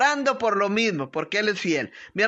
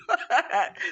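self-deprecating laugh; 2 semitones higher than usual. Real, not acted. Laughs at being called tough.

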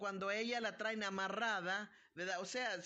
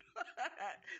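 short laugh; 3 semitones higher than usual. Real, not acted. Laughs at being called strong.

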